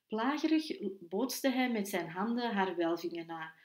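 ordinary delivery

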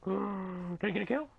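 high, slow squeaky question; gasp, then ask in drawn-out hope; hammed-up breathless hope; hopeful gasp. Gasps and asks again, hopeful.

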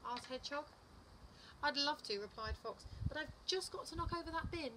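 animated picture-book narration, pitch swinging up; lively storytime voice, key words punched; story reading to children. A narrator's reading. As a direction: read it to little children with big expressive emphasis. Reads a picture book aloud.

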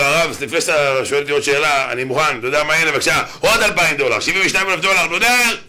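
loud plea climbing to a high shout; frantic vowing. Acted. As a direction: build from urgent offer to all-out cry. Panicked man shouts a bigger vow.

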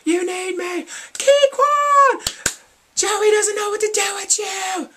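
very high, loud screaming; fangirl shout. Retold or imitated. Fan screaming flirty lines at idols. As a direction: shriek it across the hall. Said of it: "I'm like".

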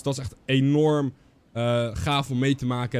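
normal talking voice

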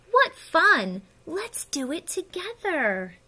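high gleeful exclamation, loud at first; happy. Acted. Pollywog invites the caterpillar to join in, happily. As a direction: exclaim with glee, then stretch it out. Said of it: "the pollywog said happily".

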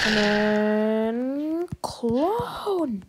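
slow unvoiced whisper; hushed, secretive whisper. Real, not acted. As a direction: breathe the words out slowly.

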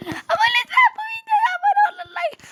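very high-pitched squeaky plea; playful falsetto begging; silly squeal. Pretend. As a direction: squeal it out like a tiny cartoon voice. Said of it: "O ses tonu neydi?".

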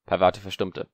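ordinary delivery, nothing marked

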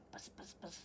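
soft unvoiced buzz buzz; whispered buzz. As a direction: whisper the buzzing. Whispered bee sound.

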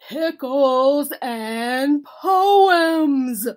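loud, very drawn-out bellow; bellowing bear. A narrator's reading. Bear laying down Christmas plans to Mouse, bellowing. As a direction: boom it out slowly like a big bear. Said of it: "bellowed the bear".

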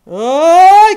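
loud, high drawn-out "ai"; startled yelp. Real, not acted. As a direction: cry out as you slip. Susto ao quase cair. (grito agudo de susto)

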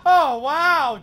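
high, laughing "oh wow"; laughing excitement. Real, not acted. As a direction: burst out laughing in surprise. Reacting to a near miss.

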